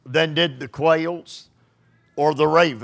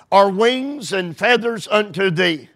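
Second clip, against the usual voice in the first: scripture reading; 3 semitones higher than usual. Acted. Reads scripture aloud, raised.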